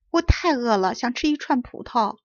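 ordinary delivery

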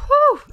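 high, loud relieved exhale; loud whew of relief. Real, not acted. Player relieved the cow is home, big whew. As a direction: blow out the tension all at once.

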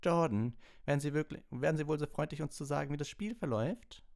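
high, loud, clipped reprimand; lightly voiced strict teacher; stern McGonagall. A narrator's reading. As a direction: cut the commentator off sharply. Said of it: "unterbrach ihn Professor McGonagalls Stimme".

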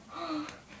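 sharp, soft intake of breath; overwhelmed gasp. Shown as a demonstration. Fan shows her reaction to being followed, overwhelmed gasp. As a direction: gasp as if stunned by good news.

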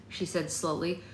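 usual speaking voice

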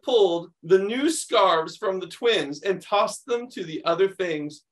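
animated picture-book reading; reading to children. Acted. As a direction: read it like story time for kids. Reads picture book aloud.

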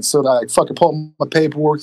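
ordinary talk, nothing marked

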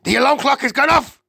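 high, fast, loud shriek; shrieking witch. A narrator's reading. Witch announcing the alarm has gone off, shrieking. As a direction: shriek it in one excited rush. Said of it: "shrieked the Grand High Witch".

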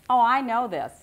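loud, lightly mocking self-assurance; mock knowing. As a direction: pipe up as if you already know it. Quotes the ego's reaction.

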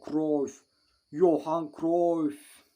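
excited cheer. Real, not acted. Gamer willing his striker to score, a long excited call. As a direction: hold the name out hoping for a goal.

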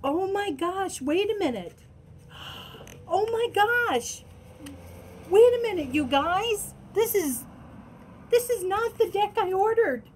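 high, loud, thrilled oh my gosh; overwhelmed with delight, voice way up; excited gasping. Real, not acted. Finding a surprise deck, she exclaims excitedly to viewers.

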